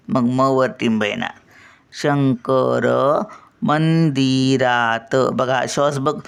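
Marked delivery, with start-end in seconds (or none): 1.9-5.1 "शंकर मंदिरात," very slow, holding "शं" and "मं"; अनुस्वार उचलून धरून. Shown as a demonstration. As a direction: stretch each nasal of "Shankar मंदिरात," then release syllable by syllable. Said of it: "श्वास पण बघा". ("शंकर मंदिरात," शं आणि मं लांबवून)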